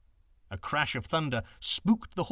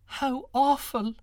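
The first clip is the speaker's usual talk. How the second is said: high, slow, loud wail; girl wailing in dismay; weeping girl; 11 semitones higher, 7 dB louder, slower than usual. A narrator's reading. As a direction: cry out in horror. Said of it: "the young girl wept".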